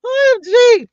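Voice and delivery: high, loud OMG shriek; excited squeal. Real, not acted. Reacting to viewer news with a loud high squeal. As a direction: burst out in delight.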